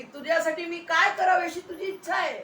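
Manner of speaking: high, sustained, declaimed question; imitated divine offer. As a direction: ask grandly, as Jesus offering anything. Preacher voices Jesus offering help. (उंच स्वरातला प्रश्न)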